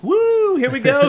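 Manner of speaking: high whooping cheer; excited cheer. Real, not acted. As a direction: cheer the start of the show. Host cheers at show start.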